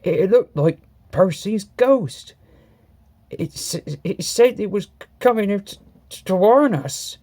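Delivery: scared, stammering, broken-off words; shaky frightened. A narrator's reading. Toby telling Thomas about the ghost, shakily stammering. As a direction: stutter out the news in fear. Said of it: "said Toby in a shaky voice".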